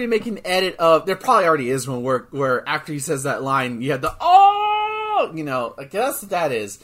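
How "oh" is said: sudden loud, much higher "Oh!" like a stunned crowd; wrestling crowd reacting to a mic drop; crowd ooh. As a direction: whoop like fans at a wrestling promo.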